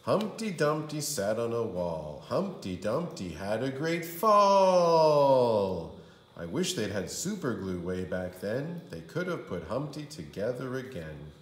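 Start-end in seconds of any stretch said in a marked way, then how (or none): 0.0-5.9 slow, loud, drawn-out cry; hammed-up loud lament; dramatic shout. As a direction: wail the fall like a disaster. Dramatic fall in rhyme.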